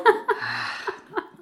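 short airy laugh, high; breathy laugh. Acted. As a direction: laugh under the breath, amused. Laughs at her argument.

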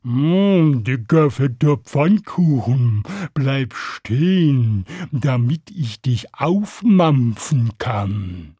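long-drawn mooing cow voice; slow cow. A narrator's reading. Cow in the meadow to passing pancake, slow mooing. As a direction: stretch every word like a heavy cow. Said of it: "die sah den Pfannkuchen kommen und sagte".

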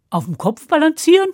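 puzzled question. Acted. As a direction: wonder aloud, rising. Hans recalls mother's advice.

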